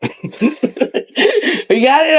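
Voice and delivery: drawn-out question, laughing through it. Retold or imitated. Wrestler asks the director if he got it, laughing. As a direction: stretch it out while cracking up. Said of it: "And I went".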